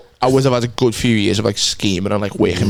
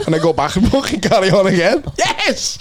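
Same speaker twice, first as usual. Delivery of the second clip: laughing; 6 semitones higher than usual. Real, not acted. Carrying on the wife bit.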